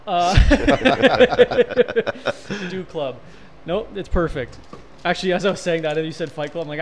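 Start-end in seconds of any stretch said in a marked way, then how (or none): none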